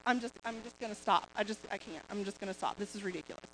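soft, fast, giving-up murmur; quitting under her breath. Retold or imitated. Exhausted runner to herself, quietly giving up. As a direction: trail off, ready to quit the run. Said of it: "And I'm just like".

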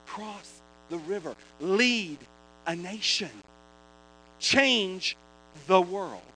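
slow, ringing prophetic declaration; a pastor prophesying strength over Joshua, at full force; full-force preaching. Acted. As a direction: preach it over him with rising power.

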